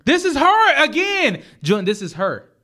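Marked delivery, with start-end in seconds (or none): 0.1-1.4 high, loud, slow disbelief; stunned, stressing her; can you believe it, pitched up; incredulous. Real, not acted.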